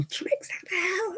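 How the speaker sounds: high-pitched calling-out yell, not full volume; mock yell. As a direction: imitate yelling for a deaf dog. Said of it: "at the top of your voice".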